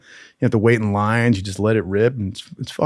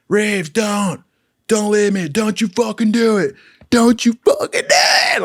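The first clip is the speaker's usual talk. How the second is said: high, mock-tearful begging, building to a cry; tearful pleading; 9 semitones higher than usual. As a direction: plead ever more desperately, acting choked up. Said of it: "I teared up for the guy".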